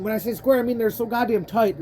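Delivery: usual speaking voice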